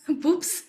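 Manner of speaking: laughing echo of BOOPS, P for B; imitated mispronunciation. Friend echoing the boyfriend's slip, amused mimicked mispronunciation. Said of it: "he always says "boops".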